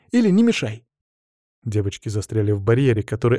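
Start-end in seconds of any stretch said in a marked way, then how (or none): 0.1-0.9 put-on high-pitched irritated girlish snap; snappish retort. A narrator's reading. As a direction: snap back high and sharp. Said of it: "огрызнулась Стефания". (огрызаясь, высоким голосом)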